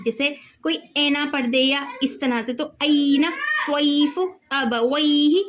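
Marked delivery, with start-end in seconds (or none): 2.8-5.4 "ऐना, त्वैफ़ु, अबवैहि" with an open ai vowel; ai vowel demo. Shown as a demonstration. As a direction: lean on the "ऐ" in each word. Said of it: "इस तरह ऐ की आवाज़ आएगी".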